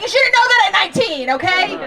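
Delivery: scolding Gen Z cousins, mock indignant. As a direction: snap at the kids, half-joking outrage. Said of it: "And I'm like".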